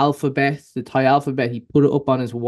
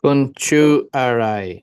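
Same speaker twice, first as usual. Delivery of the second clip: slow careful; much slower than usual. Shown as a demonstration. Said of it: "can you try".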